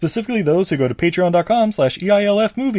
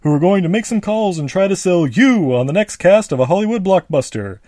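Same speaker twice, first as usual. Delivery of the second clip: loud, showy host welcome; game show host; 2 semitones higher, 4 dB louder than usual. Acted.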